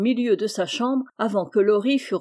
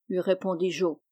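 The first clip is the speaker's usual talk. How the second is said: ordinary delivery, nothing marked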